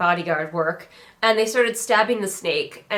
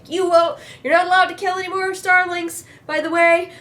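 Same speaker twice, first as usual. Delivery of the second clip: high, raised-voice shout back; retold shout; 9 semitones higher, 3 dB louder than usual. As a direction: shout a parting order while being pulled away.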